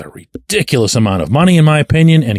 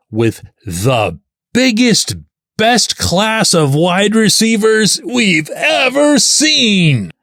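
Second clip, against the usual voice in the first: big slow announcer voice, drawn out; monster truck voice; 9 semitones higher, much slower than usual. Shown as a demonstration. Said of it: "I actually meant for that to sound like it was my monster truck voice".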